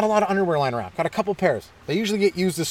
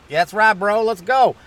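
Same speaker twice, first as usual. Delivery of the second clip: lightly voiced hyped bro; a hint of a pumped-up dude; bro scientist; 4 semitones higher, 4 dB louder than usual. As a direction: agree higher, like an eager bro. Third scientist in skit, bro.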